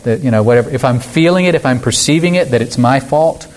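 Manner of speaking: ordinary delivery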